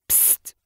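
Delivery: soft whispered psst; psst hiss. Acted. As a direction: hiss to get attention. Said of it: "A hiss stopped Crooked Jaw".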